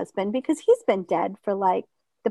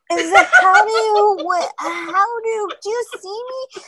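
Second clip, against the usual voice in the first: put-on confused elderly; 11 semitones higher, 4 dB louder than usual. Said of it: "people, like".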